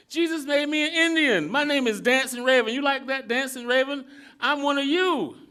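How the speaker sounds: bursting with pride, calling out; excited young Elihu. Acted. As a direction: Elihu boasts his new name to little father, loud and eager.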